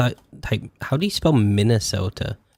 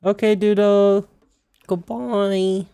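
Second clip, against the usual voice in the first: high sing-song goodbye to the pet; sweet high farewell to Doodle; 10 semitones higher, slower than usual. Real, not acted. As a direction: coo it up high like talking to a dog. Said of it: "Every time I say goodbye".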